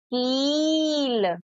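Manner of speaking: "कील" with a very long, stretched ee; drawn-out long ई. Shown as a demonstration. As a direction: stretch the vowel of "कील" slowly, pitch arching up then down. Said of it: "खींचा ना मैंने ई को".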